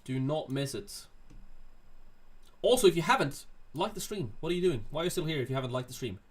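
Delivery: ordinary talk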